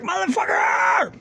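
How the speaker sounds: drawn-out high yell of pain; a slow, high cry after hitting the finger with a hammer; pained shout. Retold or imitated. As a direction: curse like you just smashed your finger. Retold cussing at hammered finger.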